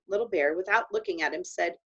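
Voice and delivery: ordinary delivery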